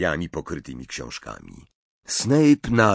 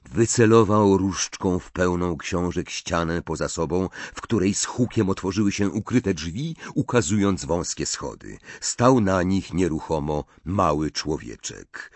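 usual speaking voice

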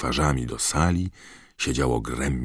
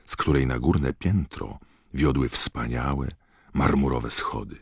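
plain reading voice

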